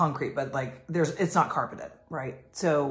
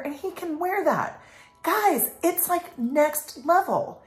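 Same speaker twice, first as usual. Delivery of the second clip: excited; 10 semitones higher than usual. Real, not acted. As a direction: gush about it with full passion. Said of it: "excited raised voice".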